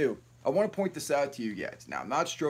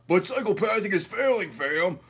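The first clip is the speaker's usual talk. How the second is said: higher, louder put-on voice for a rival; mocking impression of a rival YouTuber, a bit higher and louder; mocking CPN voice; 5 semitones higher, 3 dB louder than usual. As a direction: sneer it out like you're mimicking someone who annoys you. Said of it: "I'm gonna do my best C P N impersonation".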